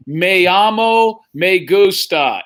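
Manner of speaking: drawn-out textbook Spanish, each word slow; slow, wooden schoolbook Spanish; slow mock Spanish. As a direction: mimic a stiff language tape, slowly.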